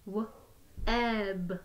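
each sound said separately with a short break; sounds tapped apart. Shown as a demonstration. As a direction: split the word into its sounds, one by one. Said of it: "Let's do that again".

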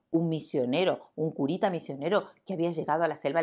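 ordinary delivery

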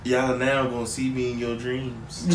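very low, hushed mock threat; soft, ominous low imitation; prisoner imitation. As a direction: quote the menace in a deep soft voice. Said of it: "He said".

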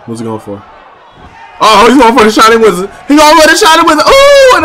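hyped play-by-play call; wrestling commentator. Acted. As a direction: call the match with hype. Excited play-by-play calling.

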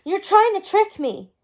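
high-pitched, upset accusation; accusing. A narrator's reading. Guinevere refusing to believe Merlin, accusing. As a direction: throw it at them in protest.

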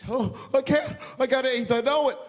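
high, loud panicky wail; acted victim in a panic; so scared. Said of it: "he was so scared".

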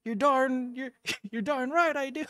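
high-pitched, hammed-up eager yes; squeaky put-on enthusiasm, chuckling midway; mock eager drunk self. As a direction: imitate your tipsy self jumping at the offer. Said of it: "And I'm like".

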